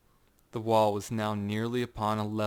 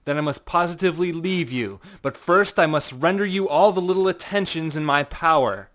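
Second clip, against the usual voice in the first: loud, higher, mocking courtesy; mocking farewell; 7 semitones higher, 11 dB louder than usual. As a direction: taunt him with false politeness, loud. Montresor mockingly bids farewell.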